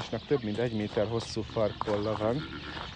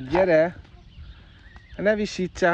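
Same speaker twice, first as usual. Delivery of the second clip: high, raised pet call; raised-voice chivvying; 6 semitones higher, 4 dB louder than usual. Real, not acted. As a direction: urge a lagging animal along, pitched up. Urging a lagging animal on.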